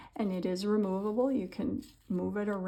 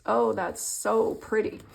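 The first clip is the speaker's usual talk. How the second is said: ordinary talk, nothing marked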